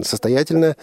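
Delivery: ordinary delivery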